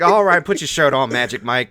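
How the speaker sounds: loud mocking taunt, brash; mocking Kano taunt. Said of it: "He's like".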